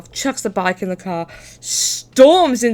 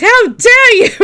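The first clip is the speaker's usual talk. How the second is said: much higher, much louder hammed-up outrage; outraged dad; 14 semitones higher, 12 dB louder, slower than usual. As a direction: fling it at the clerk. Dad furious at store.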